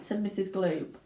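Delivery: ordinary delivery, nothing marked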